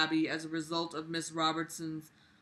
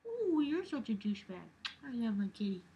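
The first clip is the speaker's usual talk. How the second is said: high, soft mocking sneer; mock him in a prissy put-on voice; 4 semitones higher, 5 dB quieter than usual. Sneering imitation of the douchebag James.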